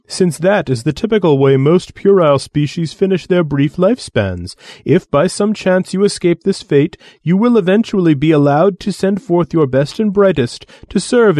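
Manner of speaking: lofty, condescending alien voice; haughty alien envoy. Acted. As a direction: sneer down at lesser beings. Alien envoy dismisses humans.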